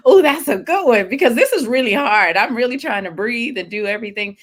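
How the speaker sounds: high, loud retold reaction; acted exertion reaction. Cyclist answering instructor during hard exercise, animated retold protest.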